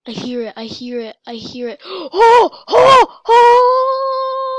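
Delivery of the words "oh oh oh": gasping, high loud screams, last one drawn out; scared screams. Real, not acted. Player jumped by the shark, frightened screaming. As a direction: scream in fright, much higher and louder. Jump scare, then "That was scary.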